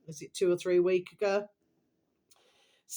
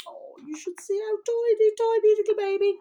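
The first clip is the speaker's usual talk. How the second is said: much higher, cooing over a tiny piglet; 5 dB louder than usual. Real, not acted.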